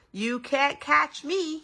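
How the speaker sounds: high, slow teasing sing-song; teasing child shout. A narrator's reading. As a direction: taunt from under the table. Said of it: "he shouted".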